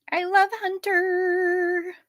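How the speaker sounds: high, slow doting coo. Real, not acted. Owner gushing over her dog Hunter, high slow coo.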